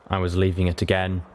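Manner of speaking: usual speaking voice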